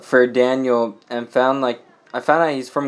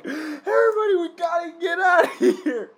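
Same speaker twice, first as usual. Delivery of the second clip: retold panicked warning. Companion runs in alarmed.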